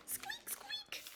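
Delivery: tiny, very high soft squeaks; mouse squeak. Acted. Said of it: "what sound does a mouse make".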